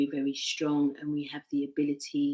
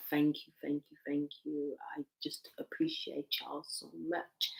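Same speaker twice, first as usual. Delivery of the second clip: a breathy whispered thank you; 2 semitones lower, 13 dB quieter than usual. Real, not acted. Whispering gratitude to her live viewers.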